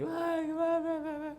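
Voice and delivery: very high imitated wailing; high-pitched mock death lament; mock mourning wail. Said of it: "sabe cómo son la gente cuando se muere alguien".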